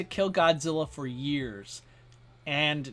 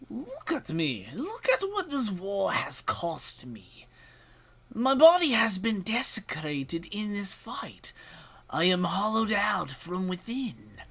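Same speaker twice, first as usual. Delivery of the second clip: cheap villain voice. Acted. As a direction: hiss it like a wounded monster. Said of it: "cheap villain voice".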